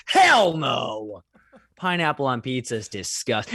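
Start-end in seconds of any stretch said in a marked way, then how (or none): 0.1-1.2 loud outraged "Hell no!"; emphatic refusal. Acted. Debater rejects the Italians' approval with a loud shout. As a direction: bark it out in disgust.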